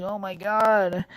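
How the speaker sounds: mild mock scream, high and drawn out; retold scream. Recalling being scratched bloody by the cat, mild retold scream. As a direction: imitate screaming without raising the volume. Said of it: "I was screaming".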